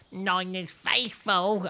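Nine is Faithful voice. Shown as a demonstration. Co-host recalling a voice for friends, high slow impression. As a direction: draw each word right out in a character voice. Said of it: "do my Nine is Faithful voice".

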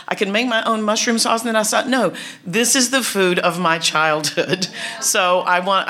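usual speaking voice

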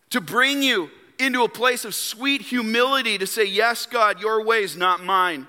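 impassioned preaching. Real, not acted. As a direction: preach it high and hard, leaning on key phrases. Preaches with raised force.

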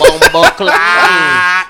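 drawn-out, loud exclamation; shouted outburst. Retold or imitated. Storyteller reliving the strip club shock, shouted outburst. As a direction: belt it out long and loud.

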